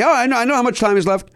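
high, fast, fake-cheerful reassurance; mock polite. Retold polite reply.

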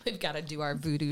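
low, soft echo of the opener; subdued repeat. Retold or imitated. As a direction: say it quietly, dropped in pitch. Repeats the opening line, subdued.

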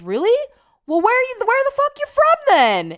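very high, loud incredulous outburst; hammed-up shouted disbelief, climbing in pitch; mock baffled neighbor. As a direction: squawk it like a baffled drunk neighbor. Imitates baffled drunk neighbor.

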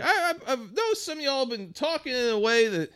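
high, gentle hippy boss voice; sweet hippy manager. Retold or imitated. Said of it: "old hi-, sweet hippy dippy guy".

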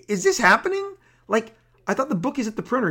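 disbelieving question. Retold or imitated. Rob hears the printed book must change, high incredulous rush. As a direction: jump way up in disbelief, then tumble on fast. Stunned by last-minute change.

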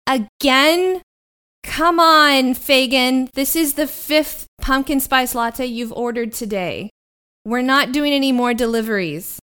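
loud voice blaring from a speaker; magically amplified voice. Acted. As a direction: boom it out over a speaker. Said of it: "you hear a loud voice come from a speaker".

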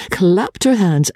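plain reading voice

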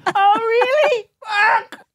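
very high, stretched comic wail; mock dismay. Reacting to news it is not ending, high mock wail. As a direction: squeal it out in hammed-up distress. Acts reaction to backhanded praise.

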